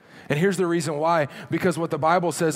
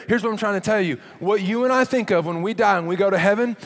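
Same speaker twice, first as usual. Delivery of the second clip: usual speaking voice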